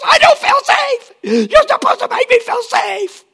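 high, loud shrieking protest; mock student screaming. Preacher mocking students screaming at professors. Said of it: "Students screaming to professors".

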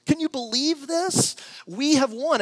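high, loud mock-giddy announcement; telling his wife they won a cruise, high and loud mock-giddy; gushing to wife. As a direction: oversell it like you hit the jackpot. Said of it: "go out and say".

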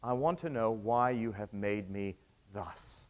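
low, slow, soft monster voice; creature's lament. Acted. Preacher voicing the monster answering its maker, low and slow. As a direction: let the words drag out low and quiet like a wounded creature. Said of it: "the creature cries back".

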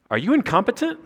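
"incompetent" thrown up high; incredulous rebuke. Shown as a demonstration. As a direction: fling it up in disbelief. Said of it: "would get my attention".

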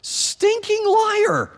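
very high, drawn-out indignant squeal; hammed-up indignation, stretching the insult way up high; mock exasperated outrage. God rebuking Ahaz's fake piety, comic outrage. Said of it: "And, and God says".

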